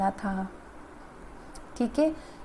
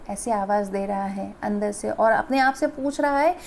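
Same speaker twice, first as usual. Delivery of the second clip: usual speaking voice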